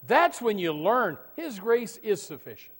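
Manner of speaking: loud, slow proclamation of grace; preaching shout. Acted. Preacher crying out to the congregation, loud and slow. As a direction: hammer it home with full force.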